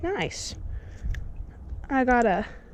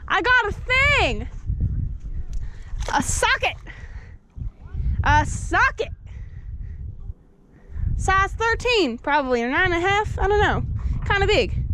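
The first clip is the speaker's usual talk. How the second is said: raised voice, stretched words; calling out; 7 semitones higher than usual. Real, not acted. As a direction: holler the news across to a friend. Calling out a find to Drew.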